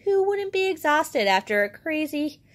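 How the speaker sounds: ordinary delivery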